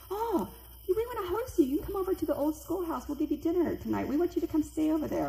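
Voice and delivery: high, enthusiastic welcome; retold eager invitation. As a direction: act out delighted hosts inviting guests in. Archaeologists eagerly invite walkers, retold.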